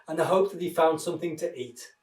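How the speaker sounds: ordinary delivery, nothing marked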